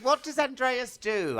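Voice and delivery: high-pitched eager question; Michael McIntyre. Retold or imitated. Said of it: "And he goes".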